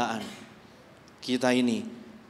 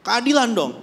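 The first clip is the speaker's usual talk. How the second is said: loud, higher, indignant cry; demanding justice; 7 semitones higher, 6 dB louder than usual. Acted. Believer crying out to God for justice, indignant. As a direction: demand punishment for the wicked. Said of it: "Kan begini".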